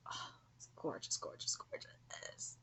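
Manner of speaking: breathless whisper of awe; whispered gorgeous, gorgeous; awed whisper. Real, not acted. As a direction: hush it like you can't believe it. Awed over artwork, hushed.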